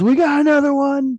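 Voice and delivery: high, loud announcement to a crew; calling out. Retold or imitated. Ride employee alerting crew about a stuck rider, loud mock call. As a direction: holler it across the room.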